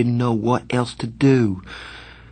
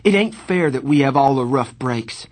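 higher, impassioned protest; heated, pitched-up complaint; passionate cry; 4 semitones higher, 4 dB louder than usual. A narrator's reading. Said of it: "I cried passionately".

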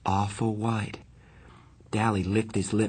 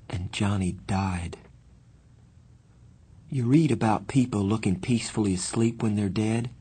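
usual speaking voice

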